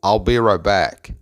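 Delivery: "I'll be right back" slowly linked, T of "right" unreleased; teacher models "I'll be right back" slowly; model pronunciation. Shown as a demonstration. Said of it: "la oración completa se pronuncia". (pronunciación modelo y pausada de "I'll be right back")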